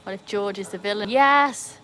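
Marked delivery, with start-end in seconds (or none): 1.1-1.8 excited yes. Real, not acted. Excited agreement.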